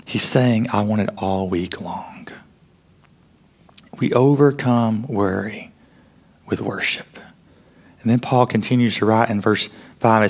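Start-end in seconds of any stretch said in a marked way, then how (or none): 0.7-2.3 hushed whisper of God's longing; soft whispered promise of devotion. Acted. Voicing God's tender longing. 4.0-7.2 whispered resolution. Real, not acted. Quiet punchline of the point.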